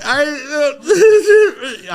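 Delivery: loud high sobbing stammer; re-enacted incoherent wail; too upset to talk. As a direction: break down into mock blubbering mid-word. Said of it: "I can't even talk".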